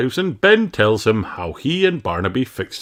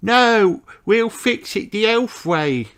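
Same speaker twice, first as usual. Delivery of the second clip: put-on high, slow insistent boy voice; a little elf standing firm; 3 dB louder, slower than usual. A narrator's reading. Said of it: "says Barnaby".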